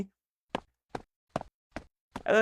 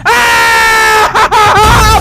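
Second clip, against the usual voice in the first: high, very loud scream breaking into laughter; laughing scream; 13 semitones higher, 12 dB louder than usual. Real, not acted. Streamer jump-scared in a horror game, laughing scream. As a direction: scream in fright and burst out laughing. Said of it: "Nakatawa na sigaw".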